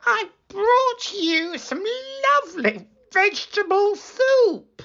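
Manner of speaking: wolf pretending to be a girl; wolf squeaking. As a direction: fake a little girl's voice. Wolf still faking girl.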